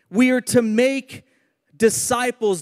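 "make" drawn out slowly, then a pause; stress on MAKE. Shown as a demonstration. Preacher teaching the church its calling, slow emphasis on "make". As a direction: land hard on "make" and hold before "disciples". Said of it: "I wanna put emphasis on that word make".

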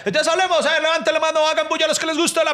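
comic hyped-up crowd address. Retold or imitated. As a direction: call out to a big crowd. Comedian addressing crowd on stage.

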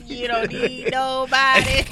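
slow, drawn-out Southern twang, laughing; country Atlanta drawl. Retold or imitated. Teasing friends by mimicking T.I.'s Atlanta drawl. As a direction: stretch every word like T.I. would. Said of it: "Because T I so country".

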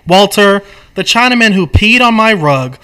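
acted aggrieved complaint; exasperated arguing. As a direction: press the point in frustration. Acted script quarrel.

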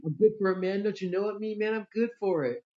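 high, loud mock of a freeloader; mooching friend. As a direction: mimic a pal insisting he'll pay. Mimics the friend who owes money.

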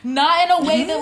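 ordinary delivery

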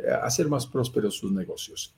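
ordinary talk

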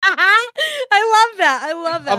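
giddy gushing after a laugh; delighted squeal. Real, not acted.

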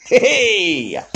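high, drawn-out "hey", sliding down; long self-mocking ta-da cry; punchline whoop. Real, not acted. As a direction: cheer your own joke. Teller cheers own punchline.

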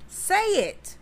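put-on high-pitched prodding of a silent child; insistent urging. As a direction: urge the kid, pitch jumping up. Retold urging child to speak.

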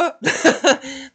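short burst of laughter; riso. Real, not acted. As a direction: laugh at own joke. Riso após piada. (rindo alto da própria piada)

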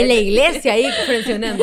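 teasing through laughter; laughing teasing. Real, not acted. Friend mocking the church friendzone story, laughing. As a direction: tease her while laughing.

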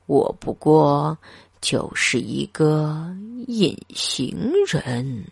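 a forgotten pensioner sighing; lonely old man. A narrator's reading.

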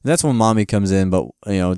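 usual speaking voice

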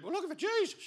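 high, mock crowd reply; squeaky crowd voice. Crowd answering Jesus the first time, high mock voice. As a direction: pipe it up high.

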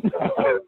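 high-pitched burst of giggling; squealing laugh; high squeal of laughter. Real, not acted. Laughing at funeral story.

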